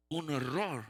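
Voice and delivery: loud, acted-out alarm; alarmed exclamation. Pharmacist spotting the prescription mistake, loudly aghast. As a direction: exclaim it in mock horror.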